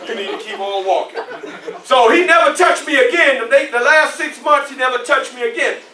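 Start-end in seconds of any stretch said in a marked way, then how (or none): 0.1-1.2 low, soft private warning; quietly muttered dismissal; inner-thought aside. Acted. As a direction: keep the challenge under your breath. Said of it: "In my mind, I'll say".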